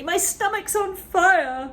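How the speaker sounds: frantic granny; panicked shout. Acted. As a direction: wail in alarm. Grandma panics.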